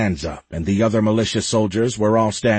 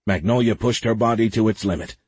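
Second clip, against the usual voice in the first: ordinary delivery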